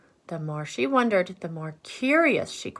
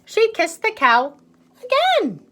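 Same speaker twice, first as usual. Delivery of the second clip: joyful; 8 dB louder than usual. A narrator's reading. Triumphant ending.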